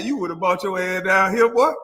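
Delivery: usual speaking voice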